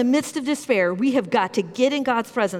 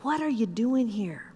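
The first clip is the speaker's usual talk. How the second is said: softened, hushed voice; lightly hushed, not fully whispered; gentle whispered question; 5 dB quieter than usual. Acted. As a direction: ask it quietly, almost under the breath. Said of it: "again in this whisper and says".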